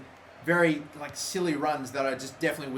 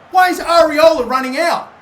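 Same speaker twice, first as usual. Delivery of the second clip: much higher and louder disbelief; angry shout; 13 semitones higher, 15 dB louder than usual. Real, not acted. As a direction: yell at the keeper's mistake. Keeper error, yelling.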